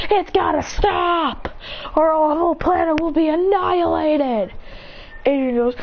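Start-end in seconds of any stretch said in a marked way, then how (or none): 0.1-4.5 urgent, higher-pitched warning; alarmed news bulletin; acted alarm. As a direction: raise the voice in pitch for a doomsday warning. News warns of planet's destruction.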